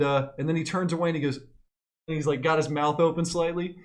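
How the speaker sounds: usual speaking voice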